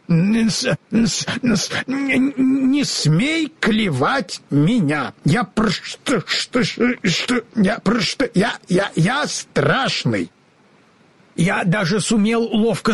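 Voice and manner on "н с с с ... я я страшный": slow, higher stutter, words breaking apart; halting stammer; stammering scarecrow. A narrator's reading. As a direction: force out each word like new lips.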